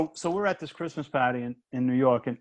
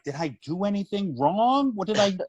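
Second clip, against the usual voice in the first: high, rising worried questions; mock panic; 8 semitones higher than usual. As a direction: send the pitch up in mock alarm. Retells his panicked self-questioning.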